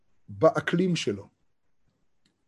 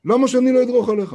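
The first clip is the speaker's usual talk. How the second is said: harder defiant retort; 7 semitones higher, 6 dB louder, faster than usual. Retold or imitated. Defiant man repeats, harder.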